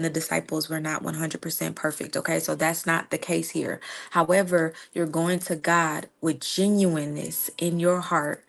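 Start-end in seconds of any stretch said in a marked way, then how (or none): none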